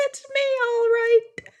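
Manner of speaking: tiny, very high squeaky voice; little orc talking in her ear; very small voice. Acted. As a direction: speak like a creature the size of a bee. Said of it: "said a very small voice".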